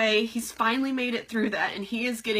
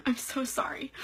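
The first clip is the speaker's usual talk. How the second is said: whispered apology through tears; real teary whisper; tearful whisper; 2 semitones higher, 4 dB quieter than usual. Real, not acted. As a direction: whisper it while crying. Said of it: "how good makeup stands up to, um, tears".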